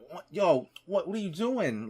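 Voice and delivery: high, loud shocked yelp; startled outburst. Retold or imitated. Bagger shocked when the old lady grabs his arm, loud yelp. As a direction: blurt it out in disbelief. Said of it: "I didn't exactly say that".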